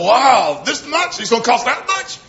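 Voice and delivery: put-on a parent reeling at what children cost; shocked at the costs. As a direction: pitch jumping up in disbelief at each bill. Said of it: "and you go".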